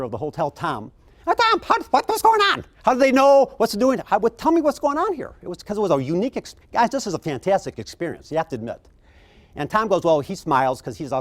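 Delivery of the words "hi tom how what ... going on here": much higher and louder, tumbling eager questions; excited questions. Retold or imitated. Guest meeting the general manager Tom, high excited questioning. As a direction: pepper him with amazed questions. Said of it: "I met the general manager".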